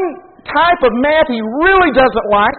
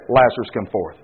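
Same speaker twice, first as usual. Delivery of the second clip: much lower and softer, a grave summons; Jesus calling Lazarus; 10 semitones lower, 4 dB quieter than usual. Retold or imitated. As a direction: call him out low and solemn. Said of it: "So he said".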